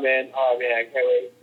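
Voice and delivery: ordinary talk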